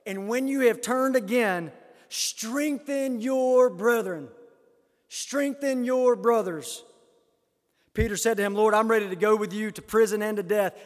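emphatic pulpit declamation; forceful scripture proclamation. Acted. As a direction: press every word on the congregation.

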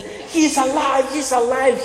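high, slow, stunned cry of he's alive; a frightened demon blurting the news, drawn out; shaken demon's alarm. Acted. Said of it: "He's shaking".

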